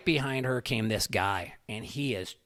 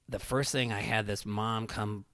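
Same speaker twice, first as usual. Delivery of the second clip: ordinary talk, nothing marked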